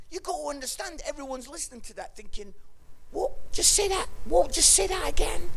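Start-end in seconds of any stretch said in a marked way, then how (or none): 3.1-5.5 high, loud baffled demand; incredulous listeners. Acted. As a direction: ask for a repeat in pitched-up disbelief. Baffled listeners asking for a repeat.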